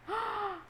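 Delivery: sharp high gasp of delight; surprised gasp. Real, not acted. Reader gasping at the surprise card. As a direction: catch the breath at the reveal.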